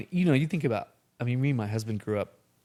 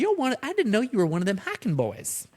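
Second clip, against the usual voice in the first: put-on thick West Texas accent; 11 semitones higher than usual. Mom insisting to the confused guest in West Texas twang. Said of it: "that real thick, you know, West Texas accent".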